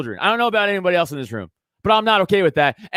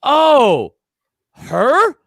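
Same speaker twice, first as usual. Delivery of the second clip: high, loud mocking disbelief; incredulous scoff; 8 semitones higher, 5 dB louder than usual. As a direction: sneer it as if the choice is absurd. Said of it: "people literally went".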